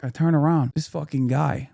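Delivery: ordinary talk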